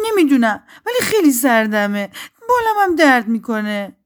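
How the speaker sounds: high, loud wail of a little bird; very upset. A narrator's reading. Hurt, cold bird answers the voice, plaintively upset. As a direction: complain miserably in a high little voice. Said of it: "پرنده که خیلی ناراحت بود گفت".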